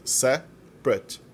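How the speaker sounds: teacher drawing out Separate in two syllables. Shown as a demonstration. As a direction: Separate said slowly in two syllables, "sep-rate". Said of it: "só tem duas sílabas".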